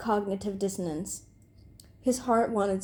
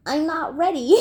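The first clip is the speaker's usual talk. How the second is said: higher, halting nervous protest; flustered stammer, rising louder; stuttering; 6 semitones higher, 5 dB louder than usual. A narrator's reading. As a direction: stumble over it anxiously. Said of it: "Beezus stuttered".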